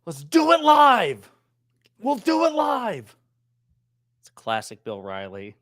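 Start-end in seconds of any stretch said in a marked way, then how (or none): 0.1-1.3 loud, high, slow angry anchor shout; Bill O'Reilly impression. 2.0-3.1 loud, high, slow angry anchor shout; Bill O'Reilly impression.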